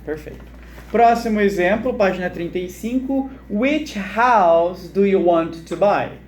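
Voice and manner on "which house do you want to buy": "Which house do you want to buy?" very slow; slow model reading. Shown as a demonstration. Teacher reading the next example to students, very slow model. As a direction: stretch it word by word.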